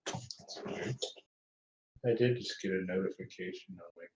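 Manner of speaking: muffled, garbled mic; like talking from under water; underwater sound. Real, not acted. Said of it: "you sound like you're underwater now".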